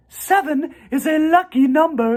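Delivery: high, gentle spider voice; a kindly spider speaking up; spider's voice. A narrator's reading. Charlotte greets the goose family in a high voice.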